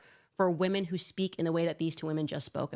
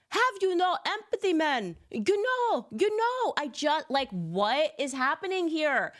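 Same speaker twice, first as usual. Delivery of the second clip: imitating a Jamaican lilt, much higher; mock Jamaican accent; 9 semitones higher than usual. Mocking Kamala on Colbert, high mock Jamaican accent. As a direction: parody her Jamaican turn, pitched up. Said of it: "rolled out a new accent".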